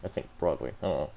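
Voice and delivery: ordinary delivery, nothing marked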